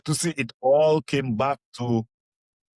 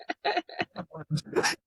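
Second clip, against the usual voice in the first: laugh; 5 dB quieter than usual. Real, not acted.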